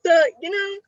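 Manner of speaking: ordinary talk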